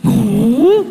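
high, drawn-out "No" rising at the end; child's denial. Acted. Son denying he scrawled on the bathroom, child's denial. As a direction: play a kid innocently denying it.

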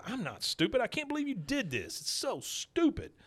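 high-pitched upset kid impression; high mock whine, dragging out the end; mock protest. Kid protesting in acted quote.

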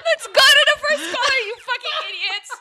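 loud, high, half-laughing mock announcement. Real, not acted. Host laughs off tears, insults co-hosts jokingly. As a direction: play the host through your own laughter.

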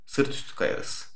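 lulling low murmur; dreamy hushed musing. Pretend. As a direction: drift off quietly, leaving long gaps. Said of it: "Gözüm kapalı dinleyeyim mi bu konuşmayı?".

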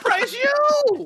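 high, slow shocked yell, hammed up; over-the-top pretend disbelief; mock shocked shout. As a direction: stretch it out like you just caught him red-handed.

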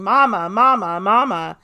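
imitated calling, each Mama pushed out loud. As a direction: retell a child calling out for Mama. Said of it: "kept calling".

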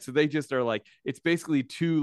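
usual speaking voice

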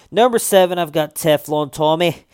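attempted Boston accent, slips Scottish; Boston accent drifting into Scottish. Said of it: "I keep going Scottish there at the end".

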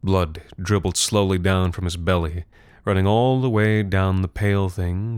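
plain reading voice

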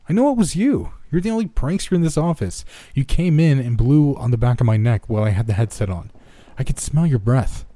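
high, loud rant; angry accusation. Acted. Tanner blaming the prankster to his face, high and angry.

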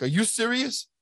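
imitating his own astonished reaction; retold disbelief. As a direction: lift the pitch as if stunned by the news, retold. Reacting to news of pardon.

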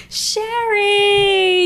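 high, drawn-out anguished call of a name; hammed-up emotional shout; mock anguished cry. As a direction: cry out the name like a grieving brother. Mimicking Polnareff crying out sister.

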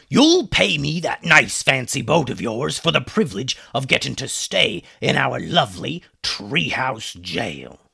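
a lightly voiced pirate, smug and taunting; gloating pirate captain. Pirate captain gloating at his prisoners, smug. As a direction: savor the threat like a smug pirate.

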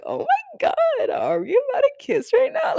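very high, gushing mock swoon; giddy. You, playing yourself swooning over relating, giddy. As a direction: gush it like a giddy crush. Acted giddy reply, joking.

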